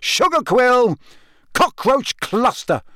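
frustrated yelling, still hopping; angry yell. A narrator's reading. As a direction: hurl the passwords at it. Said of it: "he yelled angrily".

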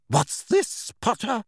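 sharp, high teacher's demand. A narrator's reading. Professor turns on Harry, sharp. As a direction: snap the question at him. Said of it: "said Professor McGonagall sharply".

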